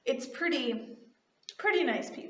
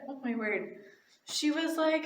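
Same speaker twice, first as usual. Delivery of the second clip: ordinary talk, nothing marked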